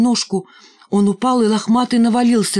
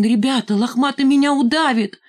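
higher-pitched, frightened appeal; pleading; 7 semitones higher than usual. A narrator's reading. Валька begs the gang to save him from Лохматый, pleading. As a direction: rise into a desperate plea for help. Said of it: "взмолился он".

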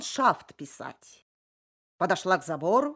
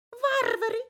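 very high cooing, falling; cooing pigeon voice. Acted. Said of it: "закурлыкали голуби". (курлыканье голубей)

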